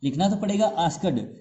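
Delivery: ordinary talk, nothing marked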